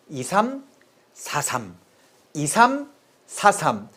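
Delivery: "이삼사삼" slowly in two pairs, 이삼 then 사삼; formation-style mnemonic. Shown as a demonstration. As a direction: pause between 이삼 and 사삼, each pair falling. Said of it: "축구 포메이션처럼 이삼사삼".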